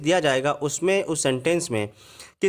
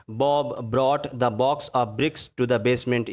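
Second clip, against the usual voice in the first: a slow, lower example line that leans on each B; slow example reading; 4 semitones lower, much slower than usual. Shown as a demonstration. Teacher reading an example to the class, slow and lower. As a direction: read it out slowly so students hear the B sounds. Reads alliteration example sentence.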